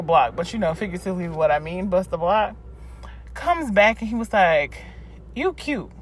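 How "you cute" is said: light, higher Caribbean-accented imitation. Guy flirting with her in passing, light Caribbean accent. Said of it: "Jamaican kind of accent".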